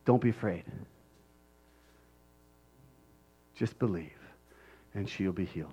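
gently calm his fear; tender reassurance. Acted. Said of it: "Jesus said".

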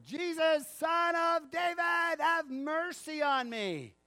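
high, slow, loud plea for mercy. Acted. Blind man shouting over the crowd, slow and desperate. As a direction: stretch it out and shout it over everyone. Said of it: "he says it again, all the louder".

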